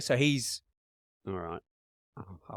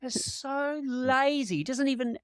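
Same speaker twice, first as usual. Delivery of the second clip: high mocking; 13 semitones higher, slower than usual. Real, not acted. Mocking the silent gesture.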